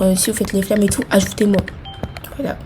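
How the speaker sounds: ordinary talk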